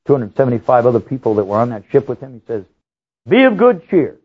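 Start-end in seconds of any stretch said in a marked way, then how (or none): 3.3-4.2 Paul's proclamation. Retold or imitated. Paul speaks to the ship.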